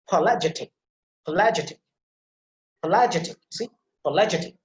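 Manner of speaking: "pelagic" for "apologetic", opening a left off; dropped initial vowel. Shown as a demonstration. Teacher drilling the word without its first a, dropped vowel in "pelagic". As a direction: model "pelagic" as "pologetic", starting on the p. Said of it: "don't have to pronounce that a sound".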